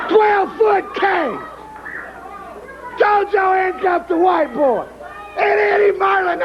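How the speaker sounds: furious shout. Real, not acted. Wrestler raging at Eddie Marlin over the cage match, shouting furiously. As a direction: blow up and holler every line.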